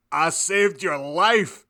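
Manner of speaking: yelled angrily. A narrator's reading. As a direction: drag out an indignant yell. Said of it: "he yelled angrily".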